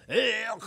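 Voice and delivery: high, gagging ugh; retold gag with the tongue held out; mock gag. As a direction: choke out a disgusted ugh. Gagging as dentist digs in.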